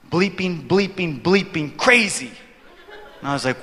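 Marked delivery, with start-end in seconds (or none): 0.1-2.3 imitated emphatic swearing, slowing at the end; exasperated, emphatic censored rant; mock cursing. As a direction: punch out each censored swearword, then stretch the last.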